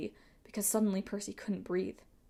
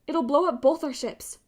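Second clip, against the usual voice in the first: raised, high-pitched alarm; urgent warning; 7 semitones higher, 6 dB louder than usual. A narrator's reading. Annabeth warns pirates carrying Greek fire, urgent and high. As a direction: warn them loudly of the danger. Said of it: "Annabeth warned".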